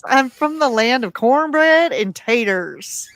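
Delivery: slow, drawn-out mock Southern drawl; a husband's Southern twang, done slowly; imitating Southern accent. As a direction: put on a folksy Southern accent. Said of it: "He says".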